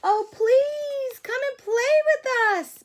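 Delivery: high, loud pleading monkey voice; begging monkeys. Acted. Monkeys begging the butterfly to play, high loud plea. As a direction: beg like squeaky eager monkeys. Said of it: "begged the monkeys".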